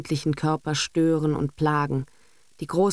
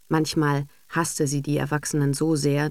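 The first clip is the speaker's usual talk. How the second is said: usual speaking voice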